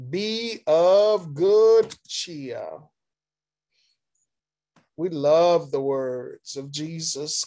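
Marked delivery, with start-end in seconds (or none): 0.1-2.8 slow, raised, ringing declaration; proclaimed scripture quote. Acted. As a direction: draw out each word, louder and higher. Reading Jesus' words to disciples.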